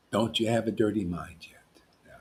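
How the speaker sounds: unvoiced teasing whisper. Real, not acted. Preacher teasing the crowd in a whisper. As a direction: lean in and whisper it.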